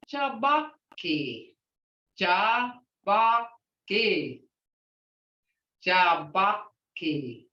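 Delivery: Jabaki said very slowly, syllable by syllable; teacher modelling Jabaki slowly; slow word demo. Shown as a demonstration. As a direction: draw out Jabaki slowly for learners.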